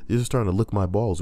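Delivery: ordinary delivery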